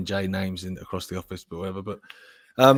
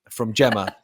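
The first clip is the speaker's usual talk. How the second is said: usual speaking voice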